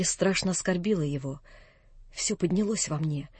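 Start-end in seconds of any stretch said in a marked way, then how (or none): none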